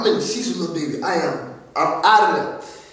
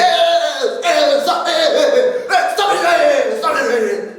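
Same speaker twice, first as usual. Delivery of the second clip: dad breaking it up; 7 semitones higher, 3 dB louder than usual. Retold or imitated. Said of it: "My dad is in the middle of it".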